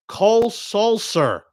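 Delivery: COLE SULSER slow and high, each word set apart; slow, punched-up name announcement; drawn-out name. Shown as a demonstration. Reliever's name announced with relish.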